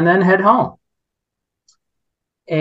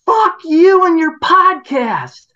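mock shriek, much higher and louder, slow; retold yell; 13 semitones higher, 5 dB louder, slower than usual. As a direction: hurl it like an angry neighbour. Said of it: "the next one was".

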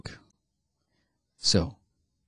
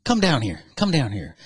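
raised mock-preacher summons; acted summoning pastor; 6 semitones higher, 4 dB louder than usual. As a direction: call him down like a joke pastor. Pastor summons man in a joke.

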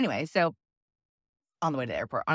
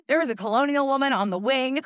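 mock panic; 8 semitones higher, 4 dB louder than usual. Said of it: "I'm like".

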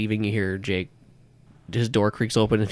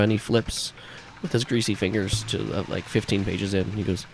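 ordinary talk, nothing marked

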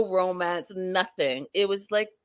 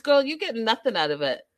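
normal talking voice

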